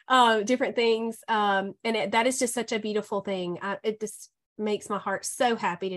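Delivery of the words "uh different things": ordinary delivery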